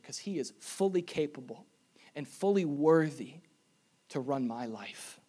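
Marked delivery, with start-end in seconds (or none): none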